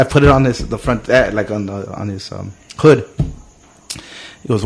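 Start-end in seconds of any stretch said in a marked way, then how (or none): none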